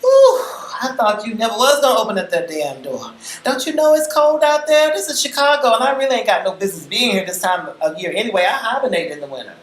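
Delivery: put-on high, loud, fast brassy older woman; Miss B rattling on. A narrator's reading. A bold older visitor barging in, talking a mile a minute. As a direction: barrel in high and loud, words tumbling out. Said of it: "talking a mile a minute".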